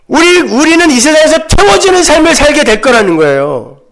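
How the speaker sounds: high-pitched, urgent sermon voice; impassioned preaching. Real, not acted. Preacher urges congregation to surrender to God, impassioned preaching. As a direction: press each point on the congregation insistently. Preacher builds to full force.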